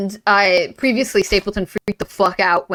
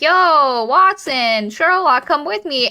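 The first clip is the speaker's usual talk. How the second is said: Henry calling out; 7 semitones higher, 3 dB louder, slower than usual. Retold or imitated. Henry calling his friends, acted.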